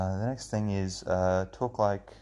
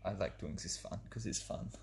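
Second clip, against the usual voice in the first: put-on admittedly poor German accent. Podcaster defending his silly voice, bad German accent. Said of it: "this is not my best German accent".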